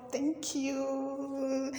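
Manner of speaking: high, sustained thank-you; drawn-out thanks. Real, not acted. Thanking viewers for subscribing, high and drawn out. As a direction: stretch it out in a high voice.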